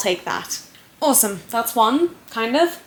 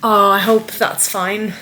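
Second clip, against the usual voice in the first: usual speaking voice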